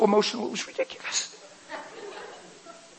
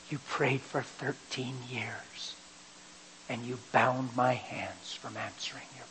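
a slow, hushed whisper; 8 dB quieter, slower than usual. Retold or imitated. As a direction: let it fall softly, slowly. God speaks to Kim quietly.

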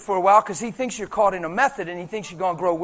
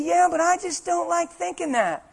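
higher, slow whiny objection; a skeptical church member objecting in a higher, slow voice; mock reluctant objection; 8 semitones higher than usual. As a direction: drag it out like a reluctant believer.

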